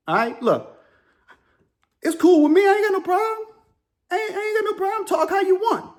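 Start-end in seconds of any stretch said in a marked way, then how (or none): 2.0-5.9 excited protest. Real, not acted. Defending against upset viewers.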